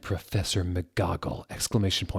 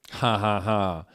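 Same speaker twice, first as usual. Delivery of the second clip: mean laugh; 2 semitones higher, 4 dB louder than usual. Acted. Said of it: "Snoop laughed, uh, meanly".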